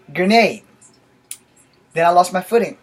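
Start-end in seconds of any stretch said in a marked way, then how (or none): 0.1-0.6 raised, loud play battle cry; mock scream. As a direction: call out like a kid playing soldiers. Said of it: "I screamed".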